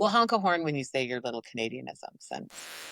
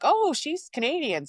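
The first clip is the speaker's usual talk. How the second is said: high, drawn-out mock discovery; mock listeners' realization; 11 semitones higher, 3 dB louder, slower than usual. Imitating radio listeners noticing her Canadian accent, mock surprise. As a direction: imitate listeners catching the accent, pitched well up. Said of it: "they'd be like".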